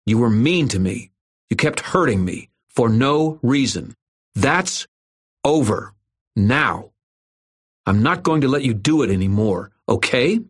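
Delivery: lightly voiced boy telling off his bully; big kid standing up to a bully. A narrator's reading. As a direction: lay down the law, done being hurt. Irwin confronts the bully.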